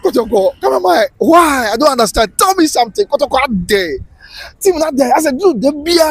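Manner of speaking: hammed-up heartbroken protest; mock anguish. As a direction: demand an answer like a distraught fan. Mock lament to the football club.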